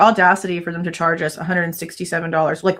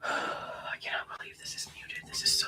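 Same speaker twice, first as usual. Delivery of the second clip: sighing whisper of disbelief; 12 dB quieter than usual. Real, not acted. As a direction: whisper your frustration under your breath. Whispering frustration during proceedings.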